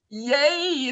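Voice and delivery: high, drawn-out cheer; mock cheer. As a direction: squeal it with playful delight.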